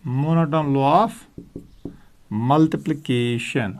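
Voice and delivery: monotone law of multiplication spoken very slowly, each word apart; slow dictation. Shown as a demonstration. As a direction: dictate the term for students to write. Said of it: "term repeated slowly".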